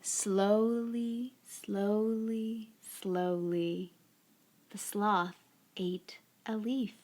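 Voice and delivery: slow, soft storybook narration; unhurried picture-book reading, softly spoken; story read to children. A narrator's reading. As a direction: draw out each phrase gently, leaving long pauses.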